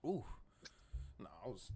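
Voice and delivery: coughing after water went down wrong; choking on water. Real, not acted. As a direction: choke on a sip, then recover. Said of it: "choking on water".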